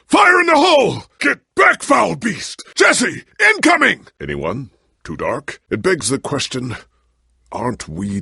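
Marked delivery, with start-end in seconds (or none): 0.1-4.1 very high, loud yelled warnings; battle shouts. Acted. Hero shouting warnings to allies mid-battle. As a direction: bellow over the fight.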